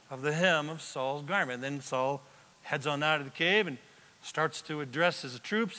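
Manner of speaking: ordinary talk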